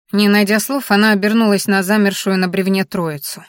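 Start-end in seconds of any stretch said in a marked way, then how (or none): none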